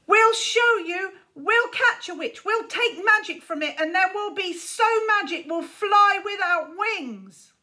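put-on a boy shouting defiance at departing riders; defiant shout. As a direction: hurl the boast after them. Shaking fist at departing rivals.

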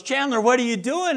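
put-on high, startled call to the boy; wife spots son in Ziploc bags, high startled call. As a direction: call out to Chandler in shock.

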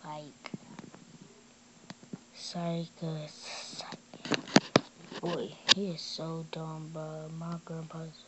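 muttering. Real, not acted. Mocking quietly after fooling grandpa.

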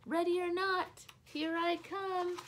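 loud, high sing-song call. A narrator's reading. Lulu calling out to the hiding monkeys, loud and high. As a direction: call it across the house to the hiders.

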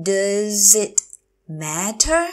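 drawn-out "Does it", pause, then "matter"; slow teacher model of "Does it matter"; slow, broken up. Shown as a demonstration. Teacher repeats phrase slowly.

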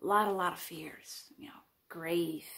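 hushed, nervous whisper; anxious whisper. Acted. Admitting her anxiety to the confessional camera, in a whisper. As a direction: let the fear slip out under your breath.

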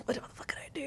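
hushed whisper. Retold or imitated. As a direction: whisper it in panic. Panicked inner thought retold.

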